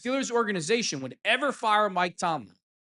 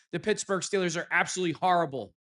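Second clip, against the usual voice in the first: ordinary talk, nothing marked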